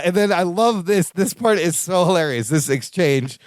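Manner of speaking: normal talking voice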